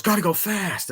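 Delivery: high-pitched mock urgency; imitated sudden outburst. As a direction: play a cartoon hero jolting awake. Acting out Sonic waking up.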